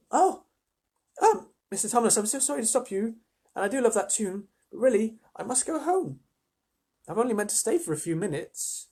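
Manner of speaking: Lucy politely begging to go home; young Lucy. Acted. Lucy voiced in story.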